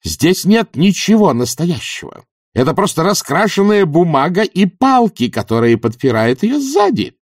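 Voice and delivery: high-pitched, satisfied proclamation; strange satisfaction. A narrator's reading. Witch exposes the painted scenery, satisfied and proclaiming. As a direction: expose the fakery to the world, gratified. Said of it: "в голосе её звучало странное удовлетворение".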